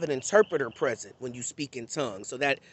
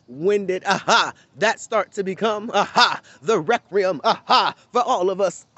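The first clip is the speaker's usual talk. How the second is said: preacher's "aha" cadence; 3 semitones higher, 5 dB louder than usual. Retold or imitated. Imitating pastors who huff aha mid-sermon, hammed up. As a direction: ham the pastor's huffing aha rhythm. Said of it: "why pastors talk like that".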